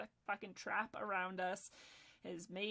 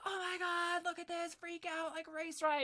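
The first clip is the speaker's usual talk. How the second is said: mocking media alarm; 9 semitones higher, 3 dB louder than usual. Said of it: "all of a sudden they're like".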